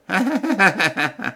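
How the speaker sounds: high scornful burst of laughter; a nobleman's derisive laugh; mocking laugh. A narrator's reading. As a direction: laugh in his face, high and loud. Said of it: "fit Caylus".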